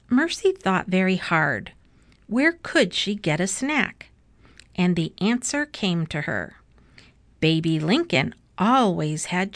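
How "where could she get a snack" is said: plain reading voice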